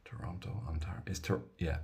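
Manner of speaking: under-the-breath check of Toronto; a quiet unvoiced mutter, thinking aloud; whispered aside. Real, not acted. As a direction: whisper the place names to yourself. Muttering places to himself.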